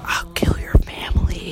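unvoiced menacing whisper; creepy whisper.

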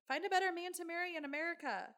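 high, loud, quick indignation; exasperated urging. Real, not acted. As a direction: urge her on loudly, fed up for her. Indignant on the young wife's behalf.

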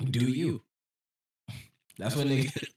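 talking through hiccups; hiccuping mid-sentence. Real, not acted. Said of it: "he got the hiccups".

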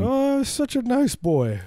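very high, slow doting gush; sarcastic cooing. Retold or imitated. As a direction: gush over the little monster as if he were sweet. Mock grandparent cooing at awful kid.

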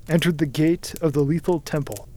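ordinary delivery, nothing marked

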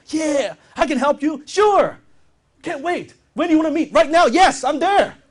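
high, loud, gushing yeses; can't wait, sound desperate to close; hammed-up eager seller; overeager salesperson. Shown as a demonstration. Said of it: "so eager, excited".

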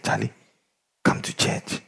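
hushed, almost whispered invitation; soft invite. Retold or imitated. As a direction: lean in and coax quietly. Quietly inviting the guy.

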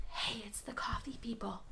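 unvoiced whisper so as not to wake him. Telling sleeping Zach who's calling, in a whisper. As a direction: hush it, he's asleep.

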